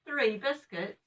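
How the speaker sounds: amazed high question; surprised. Real, not acted. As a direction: marvel at the biscuits. Nana surprised at count.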